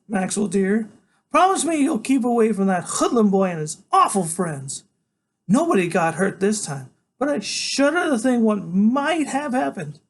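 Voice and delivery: a doting grandmother begging her grandson; fussy grandmother. Acted. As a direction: coo it like a worried granny. Grandmother voiced in story.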